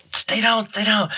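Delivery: high, hushed-level urgent plea; urgent retold warning. Hunting buddy to shooter jumping too soon, urgent high warning. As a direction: imitate a buddy warning you without yelling. Acts friend warning him off.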